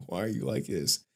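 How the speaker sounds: ordinary talk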